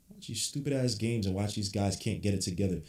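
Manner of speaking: normal talking voice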